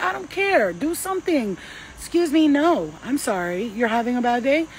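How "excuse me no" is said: high, loud indignant EXCUSE me; sassy excuse me. Shown as a demonstration. Telling bad energy off, loud and sassy. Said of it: "tell that bad energy".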